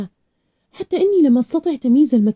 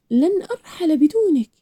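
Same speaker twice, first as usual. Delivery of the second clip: angry cry; 6 semitones higher than usual. A narrator's reading. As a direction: refuse angrily, pitch raised, volume held back. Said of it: "صرخت بغضب".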